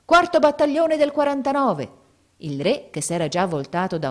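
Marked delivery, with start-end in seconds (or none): 0.0-2.0 high, loud battle-roll shout; loud, high, carried-away cry; frantic yell. A narrator's reading. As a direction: yell it out to be recognised. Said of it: "perdé la testa e urlò".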